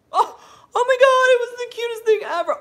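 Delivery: overjoyed high squeal; gushing squeal. Real, not acted. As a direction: squeal it like you can't contain yourself. Gushing over the boy's reply.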